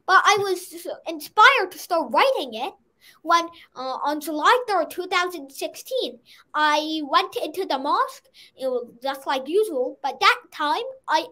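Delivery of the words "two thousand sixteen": ordinary talk, nothing marked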